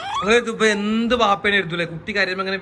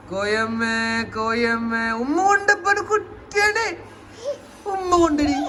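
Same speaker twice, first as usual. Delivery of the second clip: put-on baby talk; 6 semitones higher, slower than usual. Coaxing baby for a kiss.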